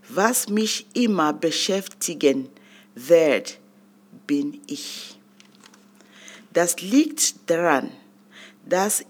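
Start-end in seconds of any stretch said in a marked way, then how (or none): 4.3-5.2 a soft whispered reveal, almost to yourself; whispered punchline of the poem; hushed whisper. Acted. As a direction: breathe it out in a low whisper. Dramatic reveal in spoken-word poem.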